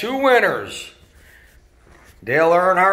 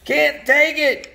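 high, crying complaint, much higher; whiny hurt referee; 9 semitones higher than usual. Acted. Referee whining at the racers who keep crashing into him. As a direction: wail like a toy referee sick of being hit. Dad voices the knocked referee.

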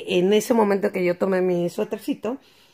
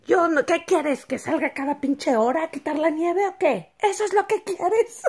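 higher mock furious shout; hammed-up angry retort; imitating angry neighbor; 8 semitones higher than usual. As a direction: fire back at the neighbor, higher. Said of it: "estaba enchiladísima".

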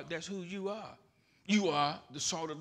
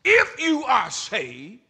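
high and loud, to show up cried out; preaching shout; 8 semitones higher, 11 dB louder than usual. Acted. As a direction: whoop it up like a revival preacher. Preacher builds repeated line.

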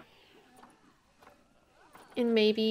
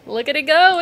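excited; 5 semitones higher, 11 dB louder than usual. Real, not acted. Machine working.

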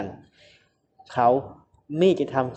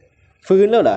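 a stern voice, pitched up and louder; sterner voice; 7 semitones higher, 8 dB louder, much slower than usual. A narrator's reading. Man to the woman waking up, in a stern voice. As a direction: make the voice hard and cold. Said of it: "ทำเสียงเข้มขึ้น".

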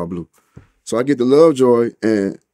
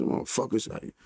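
whispered curse; cursing under breath; 3 semitones lower, 14 dB quieter than usual. Retold or imitated. Making his bed at two a.m., whispering a curse. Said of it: "talk cursing and shit".

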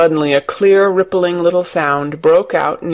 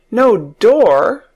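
higher, startled rising question; crying out; 4 semitones higher, 4 dB louder than usual. A narrator's reading. Mary hears the door is gone, crying out. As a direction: exclaim it in disbelief. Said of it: "cried Mary".

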